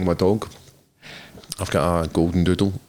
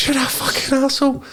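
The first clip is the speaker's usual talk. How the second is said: much higher put-on woman's voice, a mock screech; angry woman, imitated. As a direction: shriek the insult like the furious dog owner. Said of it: "she's like".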